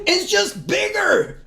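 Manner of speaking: high, slow exasperated shout; drawn-out wail of comic despair; mock outrage. Fan complaining about the bigger explosion, mock outrage.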